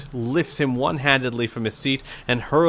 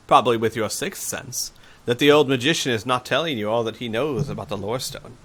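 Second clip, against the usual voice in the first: usual speaking voice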